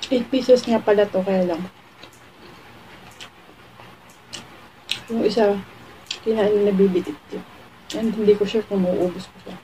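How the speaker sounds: speaking with food in mouth; mumbled mid-bite speech; talking while chewing. Real, not acted. Seen 0: Bit chicken at -5; lips pursed, chewing while speaking throughout.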